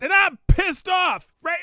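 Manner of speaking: hammed-up angry host shout; pissed off. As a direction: bellow the complaint like a catchphrase. Ranting host opens show.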